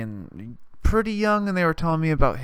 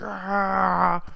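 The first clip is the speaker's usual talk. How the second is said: mock yell; 6 semitones higher than usual. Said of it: "I was like".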